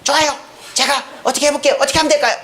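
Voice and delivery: 살로메. Acted. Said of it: "이 살로메한테". (살짝 높인 순종적인 딸 목소리)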